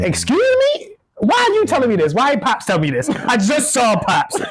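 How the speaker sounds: mock outrage at going first; shocked disbelief. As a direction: act stunned and betrayed. Retold shocked reaction.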